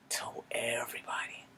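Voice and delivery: hushed aside whisper; low whispered aside. Real, not acted. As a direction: drop to a whisper like telling a secret.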